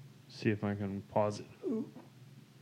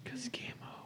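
whispering; 13 dB quieter than usual. Real, not acted. Quiet aside to child.

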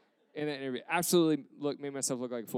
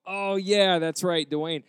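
loud, higher burst of mock remembering; feigned recognition; 4 semitones higher, 7 dB louder, much slower than usual. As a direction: pretend it has suddenly all come back. Feigns remembering the name just supplied.

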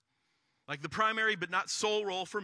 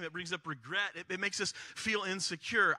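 usual speaking voice

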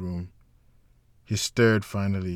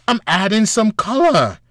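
much higher and louder, playful; playful admission; 12 semitones higher, 10 dB louder than usual. A narrator's reading. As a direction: confess it with a grin. Mara admits embellishing, playful.

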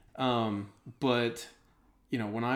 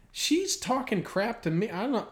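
retold protest; 9 semitones higher than usual. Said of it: "I was like".

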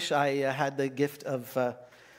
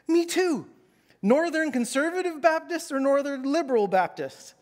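high, eager and chummy; a hammed-up overeager believer; eager me too; 9 semitones higher, 3 dB louder than usual. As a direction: jump in, keen to agree. Eager narrator in the joke.

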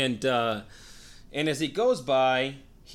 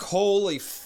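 higher, louder mock shock; imitated awestruck exclamation; retold stunned reaction; 9 semitones higher, 5 dB louder, slower than usual. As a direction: voice the whole room's disbelief. Everybody stunned by the confrontation.

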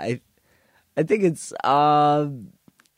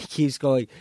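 ordinary delivery

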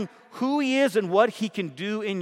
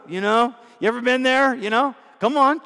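normal talking voice

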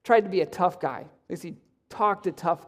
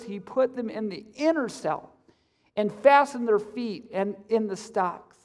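ordinary talk, nothing marked

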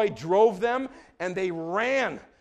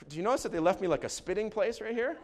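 ordinary talk, nothing marked

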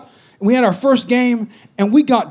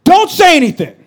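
loud, high sharp cut-off; sharp coach cut-off; 7 semitones higher, 6 dB louder than usual. Retold or imitated. Coach cutting off a player's reply, sharp. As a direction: stop the player cold. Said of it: "he was like".

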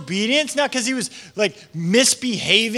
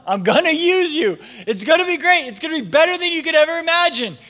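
higher-pitched, eager assurance, quickening at the end; emphatic promise; 6 semitones higher than usual. Acted. God promising to use Saul, excited and high.

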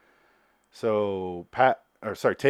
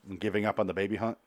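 normal talking voice

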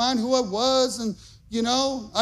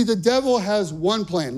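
normal talking voice

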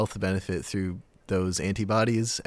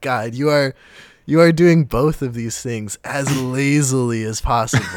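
loud, amazed rant; amazed exclamation; 3 semitones higher, 8 dB louder than usual. Retold or imitated. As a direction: marvel at it out loud. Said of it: "And I'm like".

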